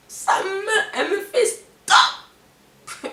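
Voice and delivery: high, slow mocking imitation; mock the mother's haughty stop; high drawn-out mock of the mother; mimicking the mother.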